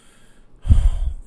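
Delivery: loud breathy sigh of relief; relieved sigh. Acted. Crying woman calming once held, loud relieved sigh. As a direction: let out one big heavy exhale.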